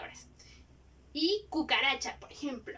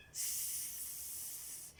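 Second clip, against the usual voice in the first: "s" hissed like meat frying; sizzling s; 18 dB quieter than usual. Shown as a demonstration. Teacher to pupils, sizzling like frying meat. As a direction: hiss "s" like a sizzling steak. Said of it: "como cuando se pone a asar un bistec".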